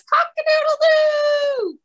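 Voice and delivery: high shouted cock-a-doodle-doo; rooster crow. Pretend. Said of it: "and he says".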